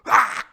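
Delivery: high, loud yell; attacking cry. Shown as a demonstration. Long John lunging at Tom, mock yell. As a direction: burst out with a pirate attack cry. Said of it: "Goes for him".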